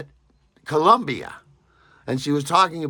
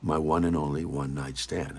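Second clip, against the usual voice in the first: normal talking voice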